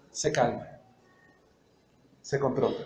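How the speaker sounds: sinks low and soft, settling into calm. Acted. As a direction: let the anger drain out, quiet and low. Pastor acts out calming down. (bajando la voz, ya en calma)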